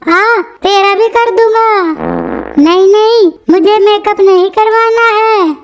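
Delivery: squeaky sped-up cartoon voice; high cartoon voice. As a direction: play it like a chipmunk cartoon. High put-on voices throughout. (Chipmunk जैसी पतली cartoon आवाज़)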